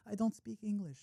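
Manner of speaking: very soft imitated girl's voice; meek reply. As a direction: say it small and hushed, as a shy stranger. Retold shy reply of foreign girl.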